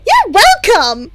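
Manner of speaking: high, loud, slow singsong; giggling. A narrator's reading. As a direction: beam back cheekily. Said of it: "Himiko giggled".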